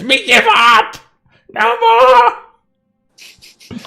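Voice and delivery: very high, loud squeal of surrender; screaming kobold. Acted. Kobold dropping its dagger before the dwarf, squealed surrender. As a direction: squeal it like a panicked little monster. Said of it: "that other kobold screams".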